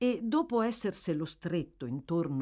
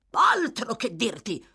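loud, raised-pitch angry retort; furious yell; 5 semitones higher, 6 dB louder than usual. Acted. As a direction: yell back in a fury. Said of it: "urlò l'ebreo".

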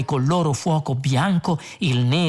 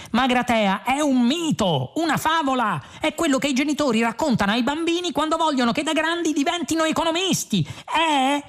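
higher, fast scoffing voice; mocking Ford; 13 semitones higher than usual. A narrator's reading. Ford scoffing at Zaphod's legend, high and fast. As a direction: rattle off his scorn quickly.

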